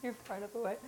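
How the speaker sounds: ordinary delivery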